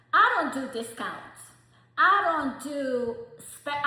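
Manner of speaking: firm and forceful. Real, not acted. Firm declaration to stylists.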